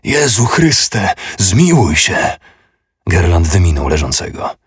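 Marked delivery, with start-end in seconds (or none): 0.0-2.4 slow, high anguished cry; a falling monk crying out to God; drawn-out pleading shout; desperate cry. Acted. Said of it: "krzycząc".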